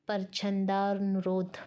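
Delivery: ordinary delivery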